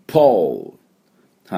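ポール stretched very slowly with a long O; ポール drawn out as a native model; slow model pronunciation. Shown as a demonstration. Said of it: "ポールっていう風に普通読んでしまいがち". (ポールをとてもゆっくり発音)

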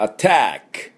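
アタック with a light throat a first; English attack. Shown as a demonstration. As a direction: start アタック with a short throat a, stress the middle. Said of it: "喉の奥で、あっていうだけ".

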